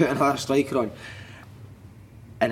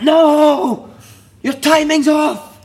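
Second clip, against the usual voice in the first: very loud, high, drawn-out rebuke; imitated manager's shout; 13 semitones higher, 10 dB louder, much slower than usual. Manager halting a warm-up drill, imitated furious shout. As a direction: bellow it to halt the drill.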